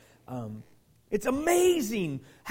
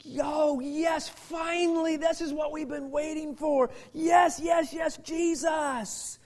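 excited cheering; 7 semitones higher, slower than usual. Retold or imitated. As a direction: cheer it like the news finally came. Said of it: "these people are like".

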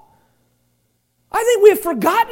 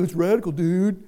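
lower, laid-back surfer dude; eighties surfer slang; 10 semitones lower, 6 dB quieter than usual. Retold or imitated. A pastor playing an eighties surfer dude, lower and laid-back. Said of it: "I want to go back to the eighties where we go".

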